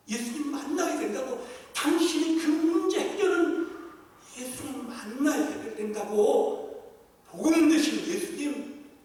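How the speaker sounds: loud, fervent closing appeal; preaching at full force. Acted. Preacher urging the congregation to share Jesus, fervent preaching. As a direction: urge them to evangelize with rising fire.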